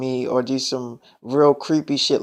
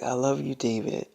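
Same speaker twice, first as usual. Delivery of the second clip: much softer, tender and hushed; soft tender goodbye; 9 dB quieter than usual. Retold or imitated. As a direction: let it fade out lovingly. Girlfriend says goodbye tenderly.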